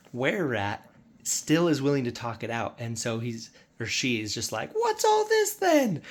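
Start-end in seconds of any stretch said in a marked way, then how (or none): 4.7-6.0 very high, loud, slow character voice; drawn-out, high-pitched rat voice; wererat guard. Acted. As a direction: squeal it as an affronted rat creature.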